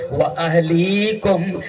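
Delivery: slow, drawn-out Arabic recitation; قرآنی آیت کی دھیمی تلاوت; Quran recitation. Acted. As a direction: intone the verse with reverence.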